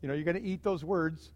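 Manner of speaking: soft and low, gently teasing; a quiet chuckle in the voice; amused chuckling Jesus. Retold or imitated. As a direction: hush down and tease him kindly. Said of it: "Jesus had to just kinda be chuckling".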